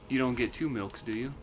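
normal talking voice

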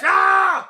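high open scream; sustained "Ah!" scream; demonstration yell. Shown as a demonstration. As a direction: show how to power up with a yell. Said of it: "Like this!".

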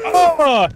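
high, loud yell as the barrel hits; smashing an enemy with a barrel, loud yell; battle yell. Real, not acted. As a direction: throw everything into one short cry.

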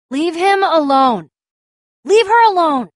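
high, forceful, drawn-out yell; protective shout. Acted. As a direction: shout them off your friends. Yelling at someone attacking friends.

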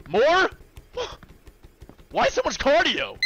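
high loud protest with a gasp; outraged disbelief. Real, not acted. Shocked by more cardio.

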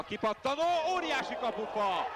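high, rising commentator burst; excited call. Real, not acted. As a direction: sell the near miss, pitch up. Ball hits the post.

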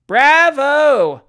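loud, high, drawn-out cheer; high-pitched shouted bravo. A narrator's reading. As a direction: cheer loudly from the audience. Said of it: "shouted the painter's party".

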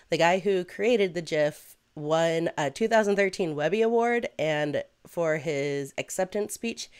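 ordinary talk

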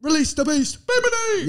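high far-off cheering call; high-pitched fan cry; hype shout. Acted. Shouting a drag catchphrase.

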